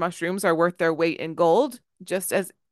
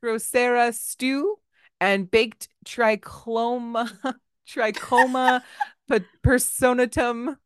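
slow, higher-pitched, halting Latin; tentative sounding-out; 4 semitones higher, slower than usual. Real, not acted. Reader struggling through Latin recipe titles, slow and tentative. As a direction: sound out each unfamiliar name slowly.

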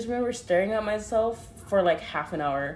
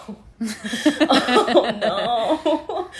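laughing; 5 semitones higher, 6 dB louder, much slower than usual. Retold or imitated. Retelling panic while high.

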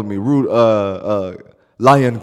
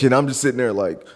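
ordinary talk

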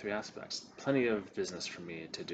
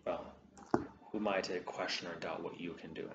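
usual speaking voice

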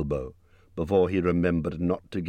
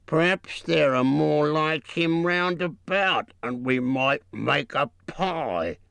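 troll voice; 9 semitones higher, slower than usual. A narrator's reading. Troll Bert plans.

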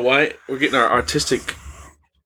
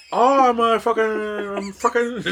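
high, halting fake Bangladeshi scammer; mock Bangladeshi accent; 7 semitones higher, slower than usual. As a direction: stall for words in a put-on Bangladeshi accent. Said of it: "they always say fucking".

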